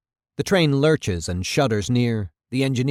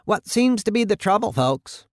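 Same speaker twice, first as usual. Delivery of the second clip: higher-pitched country voice calling; a hint of a countryman hailing from afar; lightly voiced rural man; 9 semitones higher than usual. A narrator's reading. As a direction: call over in a light rural voice. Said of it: "Rural voice, distant".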